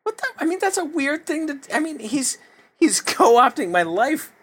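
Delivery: high-pitched, exaggerated indignation; indignant outburst. Retelling indignant reaction to stolen post.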